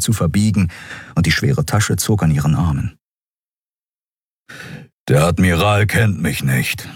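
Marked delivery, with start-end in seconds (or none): 5.1-6.9 lightly voiced grumbling beast-man; growling. A narrator's reading. Cat-man tells woman the admiral doesn't know him, lightly growling. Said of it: "brummte der Skria".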